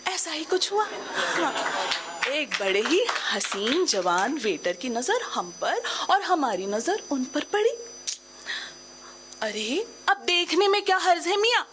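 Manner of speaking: theatrical middle-aged woman, lively and rueful; middle-aged woman monologue. Acted. Woman confides her midlife confusion to the audience, theatrical storytelling. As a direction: tell it as a stage monologue, with dramatic pauses.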